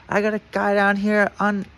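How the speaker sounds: high imitated alarm on the radio; mock urgent radio call. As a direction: call it in fast, high-pitched. Sheriff radios about loose inmate.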